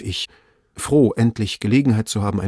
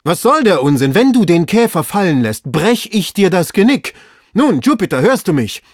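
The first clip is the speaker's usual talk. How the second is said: loud, high, threatening rant; shouted scolding; 8 semitones higher, 8 dB louder than usual. A narrator's reading. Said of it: "schrie Legrand".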